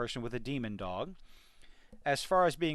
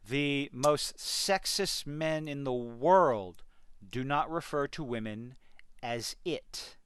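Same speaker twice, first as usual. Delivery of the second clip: ordinary delivery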